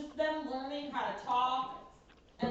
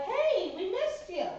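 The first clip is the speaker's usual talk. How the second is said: lightly voiced happy puppies, higher; mock puppy greeting, higher and bright-eyed; puppies' voice; 8 semitones higher, slower than usual. Puppies greeting their owner, lightly voiced higher. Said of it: "they would be like".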